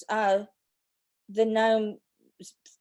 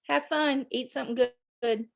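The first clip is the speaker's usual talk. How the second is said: ordinary talk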